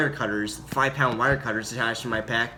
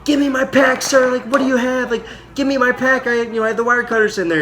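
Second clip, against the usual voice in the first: high, louder mock yell; retold yelling; 10 semitones higher, 4 dB louder than usual. Marine yelling at platoon commander for his pack, high retold yell. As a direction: imitate yelling a frantic demand, pitched way up. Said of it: "I start yelling at my platoon commander".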